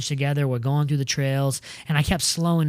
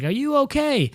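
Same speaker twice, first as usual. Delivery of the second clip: high-pitched put-on check-in; imitating concerned staffer; 10 semitones higher than usual. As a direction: ask in a raised mimicking voice.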